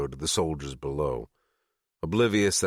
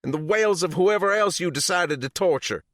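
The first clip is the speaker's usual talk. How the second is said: bitter fury; 14 semitones higher, 6 dB louder than usual. A narrator's reading. Sadie raging at Chop.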